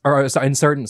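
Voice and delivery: ordinary talk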